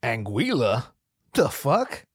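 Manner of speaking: normal talking voice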